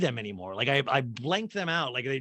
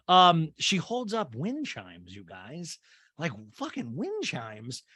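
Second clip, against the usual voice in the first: usual speaking voice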